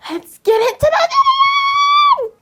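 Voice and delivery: excited shout. Real, not acted. Intro shout to start video.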